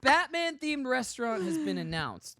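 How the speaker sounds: slow, high headline read; news announcer. Acted.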